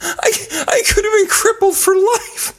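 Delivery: very high, strained comic wail; distraught protest. Acted. Wrestler recounting his split neck, distraught high wail. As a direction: wail it in exaggerated alarm. Mock outrage at his injury.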